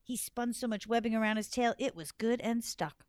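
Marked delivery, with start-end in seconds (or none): none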